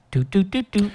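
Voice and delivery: choppy robot beeps. Shown as a demonstration. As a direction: speak like a stiff robot. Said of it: "unless you're talking like a robot".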